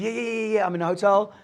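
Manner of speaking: flustered brush-off. Retold or imitated. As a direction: bluff past the awkward question. Caught out by landlord about rent.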